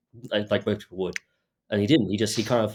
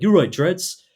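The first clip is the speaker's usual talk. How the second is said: high, loud mock heckle; imitated shouting; 9 semitones higher, 8 dB louder, slower than usual. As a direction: call it out sharply, pitched well up. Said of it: "started shouting out".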